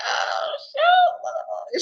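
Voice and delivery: stunned exclamation. Retold or imitated. Fan recalling the actress stepping out, in a stunned yelp. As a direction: blurt it out like she just stunned you. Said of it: "I was like".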